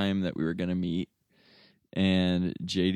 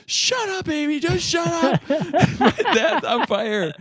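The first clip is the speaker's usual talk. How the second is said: very high, louder mock yell; imitating a frantic friend snapping at his wife, pitched way up; angry yell; 6 dB louder than usual. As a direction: friend yelling at laughing wife to shut up, hammed-up shout. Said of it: "J D's like".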